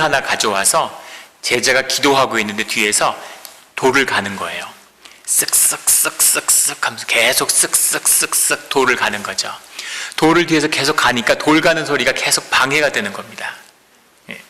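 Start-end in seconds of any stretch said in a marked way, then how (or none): 5.2-9.0 grinding sound. Retold or imitated. Imitating stone grinding sound.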